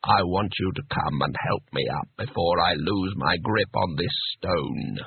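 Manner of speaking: low, deliberate disembodied voice; voice from the depths. A narrator's reading. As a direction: speak slowly and deeply from down a stairwell. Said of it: "voice rose from the depths of the stairwell".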